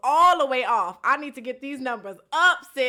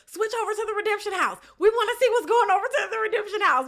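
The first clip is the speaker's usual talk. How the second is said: mock eager viewers; 7 semitones higher, faster than usual. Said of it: "you guys are gonna be saying".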